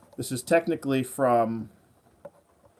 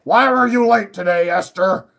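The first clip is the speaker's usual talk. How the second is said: high, louder mock boss scolding; lightly hammed-up nagging boss; 6 semitones higher, 8 dB louder than usual. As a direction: boss figure asking why she was late. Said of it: "This guy's like".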